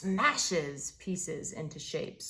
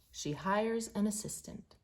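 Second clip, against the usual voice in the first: ordinary delivery, nothing marked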